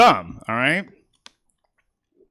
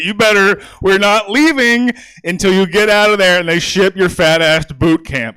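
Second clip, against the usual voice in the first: a hint of a barking cop, louder; stern cop; 4 semitones higher, 5 dB louder than usual. Comic imagines cops ordering him off to boot camp, mock stern. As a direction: push it out like a cop ordering a recruit. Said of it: "and just like".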